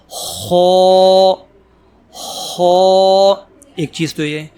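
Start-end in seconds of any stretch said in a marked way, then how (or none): 0.1-3.3 خا said heavy, with a full mouth; full-mouthed kha. Shown as a demonstration. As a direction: fill the mouth on خا. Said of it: "منہ بھر کے پڑھا جاتا ہے". (منہ بھر کے خا)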